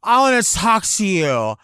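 high, shouted over the music; obnoxious pushy yelling in someone's face; loud club acquaintance. Retold or imitated. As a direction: holler it, dragging the words out. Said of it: "somebody comes and grabs you by the arm".

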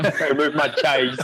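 slow, laughing, hammed-up Cockney; Cockney accent. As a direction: drawl it out in broad Essex Cockney. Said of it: "my Cockney Essex accent".

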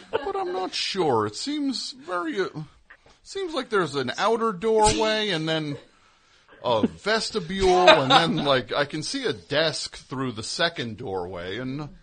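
high, hesitant, hammed-up bewilderment; mock helpless dithering. Confused fan outside a doctor's office, high mock-helpless dithering. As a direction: dither in a high voice, as if baffled by a doorway.

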